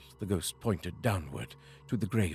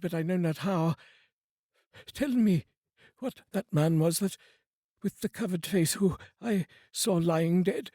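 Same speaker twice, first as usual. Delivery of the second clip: put-on higher, urgent old miser pleading; anxious Scrooge; 9 semitones higher than usual. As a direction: press the spirit for answers, uneasy. Scrooge addresses the spirit.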